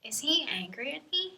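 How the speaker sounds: hushed whisper, thinking to oneself; barely voiced, wondering aloud; inner thought. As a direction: whisper it like a private worried question. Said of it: "[name] thinks to herself".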